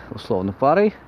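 ordinary delivery

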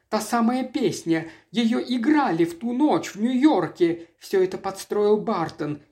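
a touch of panic, pitched high; agitated alarm. A narrator's reading. Lola tells of that night in New York, alarmed.

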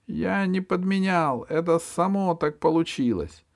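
high, scared pleading; frightened squeak. A narrator's reading. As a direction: squeak out an excuse. M pleads to rat.

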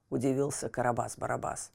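ordinary delivery, nothing marked

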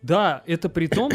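ordinary talk, nothing marked

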